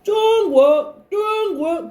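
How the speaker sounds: 둥귀 high, with 둥 held level; high, intoned 둥 falling onto 귀; mimicking Chinese sound. Shown as a demonstration. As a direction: say 둥귀 twice as if speaking Chinese. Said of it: "왠지 중국말 같지".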